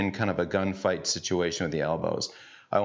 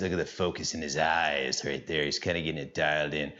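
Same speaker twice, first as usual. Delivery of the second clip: hushed commentator voice; 5 semitones lower than usual. Acted. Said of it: "I don't know why I wanna talk like that".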